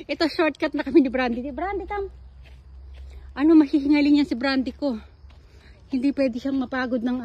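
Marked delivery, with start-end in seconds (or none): 1.5-2.1 high coaxing call to a pet; calling the dog. Real, not acted. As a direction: coax your dog along in a high voice. Calling her dog to come.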